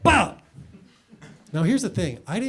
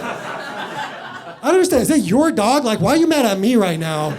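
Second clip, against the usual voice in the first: higher, louder confused protest; why are you mad, bewildered; exasperated disbelief to the doctor; baffled outrage; 7 semitones higher, 6 dB louder than usual. Retold or imitated.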